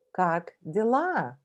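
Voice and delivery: "как дела" slowly, stress on LA; slow model question. Shown as a demonstration. Teacher models how are you. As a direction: lay out "как дела" word by word. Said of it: "repeat after me".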